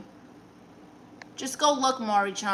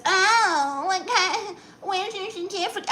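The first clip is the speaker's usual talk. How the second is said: much higher, sneering put-on voice; mock skeptic; 9 semitones higher than usual. Mocks viewers who ask for her therapist certificate, in a high sneering voice. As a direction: sneer like a doubter.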